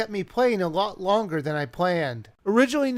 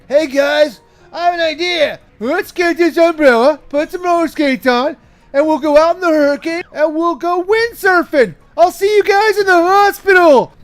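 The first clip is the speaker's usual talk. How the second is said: put-on loud, high dumb teen voice; stupid teenager; 7 semitones higher, 9 dB louder than usual. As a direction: pitch a reckless idea to friends. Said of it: "what some stupid teenager would come up with".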